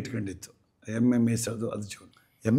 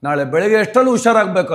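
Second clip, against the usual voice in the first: demanding prayer; 6 semitones higher, 6 dB louder than usual. Retold or imitated. Husband bargains with god Thimmappa.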